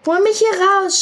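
high, loud cry to be pulled out; shout for help. A narrator's reading. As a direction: yell it like she is drowning. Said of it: "schrie sie". (hoher, lauter Hilferuf)

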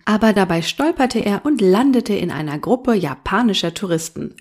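plain reading voice

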